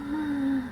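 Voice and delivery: soft, sharp intake of breath; mock startled gasp; quick gasp. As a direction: gasp quietly as if noticing a smudge. Acted reaction to smudged makeup.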